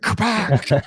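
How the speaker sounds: high, loud kaposh; mock drill noise. Stepdad punching the door, high loud kaposh. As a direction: bang it out like a punch. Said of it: "He just said".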